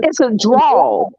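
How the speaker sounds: slow drawn-out Southern "drawl"; slow Southern drawl demo. Shown as a demonstration. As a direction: stretch "drawl" long and lazy. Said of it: "called a Southern drawl".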